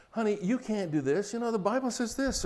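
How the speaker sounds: a hint of a sanctimonious husband; mock preachy husband. Husband correcting his wife with Bible verses, mock preachy. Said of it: "That's educating her".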